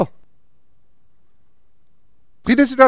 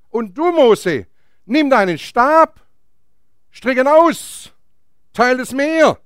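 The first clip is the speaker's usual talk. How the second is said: raised, drawn-out orders; commanding God; 4 semitones higher, slower than usual. Acted. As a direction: give Moses each order with ringing emphasis. Acts God commanding Moses. (feierlich befehlend, mit erhobener Stimme)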